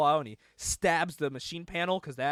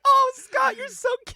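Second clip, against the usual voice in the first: very high-pitched mock swooning; gushing sweetheart; 8 dB louder than usual. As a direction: coo it sky-high like a smitten girlfriend. Acted Jean gushing over Scott.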